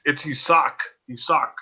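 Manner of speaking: Isaac as "ee-SOCK", repeated to correct; Isaac with an "ee" start and "sock" ending; Spanish pronunciation. Shown as a demonstration. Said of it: "That's how you say it".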